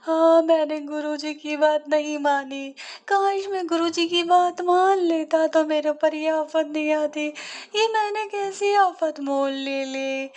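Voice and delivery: high, slow wailing regret; crying lament. Acted. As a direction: wail over ignoring the guru's advice. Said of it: "रोता-रोता कहता है".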